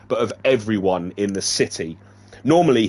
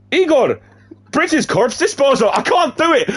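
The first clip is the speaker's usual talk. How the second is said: high, loud put-on Eastern European voice; a hammed-up Igor accent; Igor's accent; 14 semitones higher, 4 dB louder than usual. Voicing Igor greeting Sam in a mock Eastern European accent. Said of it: "That's not me trying to do an accent".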